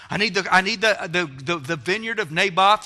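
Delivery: loud, high whiny sulk; pouting king. Acted. Ahab whining to Jezebel, loud pouting. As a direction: whine like a spoiled king. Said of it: "He'd go out and pout".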